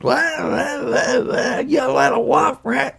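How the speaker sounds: high shrill mock whine, then shout; whiny screeching girl. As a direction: whine like a bratty kid. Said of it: "she just".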